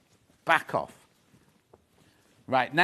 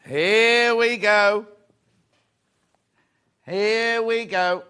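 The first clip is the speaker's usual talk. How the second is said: slow, loud and higher; drawn-out fanfare; 5 semitones higher, 8 dB louder, much slower than usual. Acted. Teasing viewers before the reveal, drawn-out fanfare. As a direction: sing it out like a fanfare. Repeated announcement.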